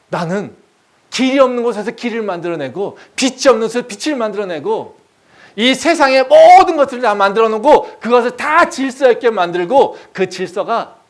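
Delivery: loud, high, mounting proclamation; grand, forceful pulpit declaration; almighty God. Acted. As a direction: declare supreme power at full voice. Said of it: "예레미야한테 뭐라고 얘기하십니까?".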